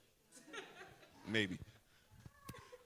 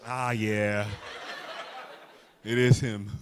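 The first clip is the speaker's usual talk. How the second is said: lower, a touch mocking wife's reply; mock woman's answer; 7 semitones lower, 6 dB louder, slower than usual. Comic mimics the wife in the crowd, lower and sly. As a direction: play the wife admitting it's him. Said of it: "She like".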